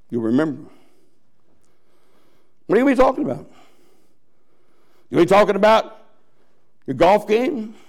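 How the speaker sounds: normal talking voice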